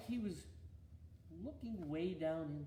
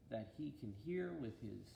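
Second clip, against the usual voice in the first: ordinary delivery, nothing marked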